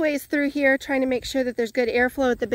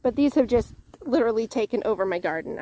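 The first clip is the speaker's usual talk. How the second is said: ordinary talk, nothing marked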